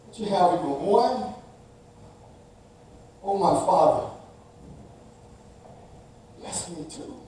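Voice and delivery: soft, hushed broken plea; pleading. Acted. Esau pleading softly with his father, broken. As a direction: plead quietly, almost spent. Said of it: "Esau pleaded".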